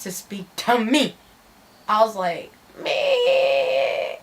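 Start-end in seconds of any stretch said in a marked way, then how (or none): 2.8-4.2 long, high-pitched drawn-out groan; squeaky retold dread; mock whine. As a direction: stretch it into a high reluctant whine. Mock whine on being handed phone.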